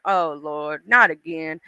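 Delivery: low, resigned sigh of a head-shaker; weary God. Acted. God looking down on us shaking his head, weary. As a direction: groan it low, fed up with them. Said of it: "shaking his head, going".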